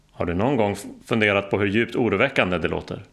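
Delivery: usual speaking voice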